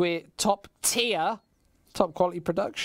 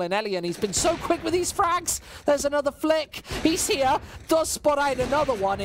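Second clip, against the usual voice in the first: higher, quicker hype on each frag; excited call; 8 semitones higher than usual. Real, not acted. Caster hyping Elian's quick frags, excited call. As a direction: ride the action up.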